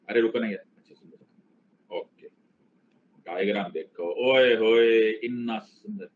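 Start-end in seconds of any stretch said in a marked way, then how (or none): 0.8-2.3 unvoiced whispered aside; hushed whisper. 4.3-6.1 mock gush. Mock admiring own diagram.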